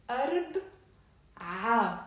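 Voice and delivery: أرب then a separate ع after a pause; teacher splitting أربع apart; syllable split demo. Shown as a demonstration. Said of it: "say with me".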